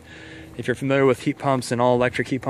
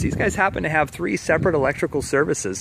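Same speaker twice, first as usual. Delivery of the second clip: ordinary talk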